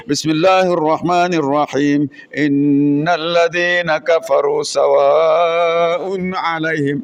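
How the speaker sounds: slow, chanted Quran reading; Quran recitation. Acted. Student reciting the Quran to the class, slow chant. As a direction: recite it slowly with care.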